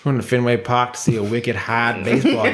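hammed-up Boston accent, dropping the R's. Shown as a demonstration. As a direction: do a joke Boston accent on request. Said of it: "give me an example of a Boston accent".

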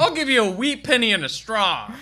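put-on high, loud old-timey offer; high loud mock offer; hammed-up haggler. Spoof haggling customer.

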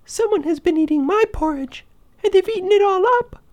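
tiny little squeaky voice, very high; Baby Bear. A narrator's reading. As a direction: wail it as an upset little bear cub. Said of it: "cried out in his tiny little voice".

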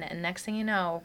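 ordinary talk

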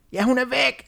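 high squeaky witch echo. Acted. Witch Dumme echoes her sister, high witch voice. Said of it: "sagde dumme".

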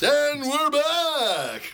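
high, very slow drawn-out announcer call; radio announcer voice. Acted. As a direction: stretch it like a radio host. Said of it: "I love the radio voice".

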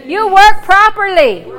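loud, slow commands to the body; preaching at full force. Acted. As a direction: call out each body part, congregation echoes. Call-and-response healing.

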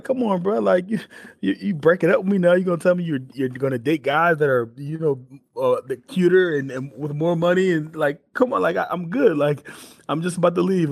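high-pitched, wounded pleading; mock-hurt protest. Acted protest, likened to a breakup.

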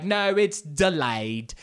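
drawn-out, insistent British correction; mock British agent sticking to her story; bad British accent. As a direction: stretch DELAYED in a put-on British accent. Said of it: "there's this British woman back there".

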